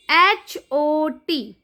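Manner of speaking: very slow, letter by letter; slow teacher spelling-out. Shown as a demonstration. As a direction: spell each letter apart for a child. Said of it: "अब यहाँ पे पहले ध्यान से देखिए".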